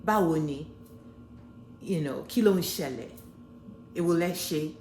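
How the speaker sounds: "Báwo ni?" said with Yoruba tones; Yoruba greetings. Shown as a demonstration. Showing the audience Yoruba greetings, in Yoruba. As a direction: speak these in Yoruba, with the tones. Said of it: "what about Yoruba?".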